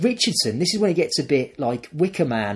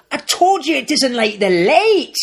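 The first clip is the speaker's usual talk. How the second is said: high, drawn-out mock scream; hammed-up shrieked scolding; screaming little girl; 12 semitones higher, 4 dB louder, slower than usual. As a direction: imitate a child yelling in panic. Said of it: "little girl screams".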